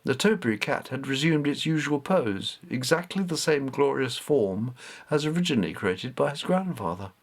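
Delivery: plain reading voice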